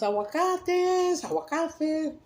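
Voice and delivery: high, slow drawn-out vendor cry; long high market call; street vendor call. Retold or imitated. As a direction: sing it out like a boy hawking avocados. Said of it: "he yells".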